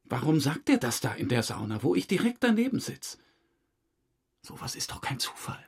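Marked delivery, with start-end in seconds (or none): 4.4-5.7 hushed conspiratorial whisper; secretive whisper. Real, not acted. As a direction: lean in and whisper.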